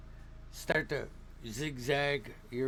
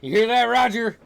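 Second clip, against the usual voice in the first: exuberant shouted host; 8 semitones higher, 11 dB louder than usual. Acted. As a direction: call it out loud and high, stretching the words. Game master voices eager host character.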